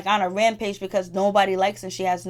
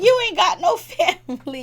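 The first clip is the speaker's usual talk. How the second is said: higher, louder, laughing mid-line; laughing insult; 9 semitones higher, 4 dB louder, faster than usual. Retold or imitated. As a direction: crack up while repeating the jab. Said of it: "She was like".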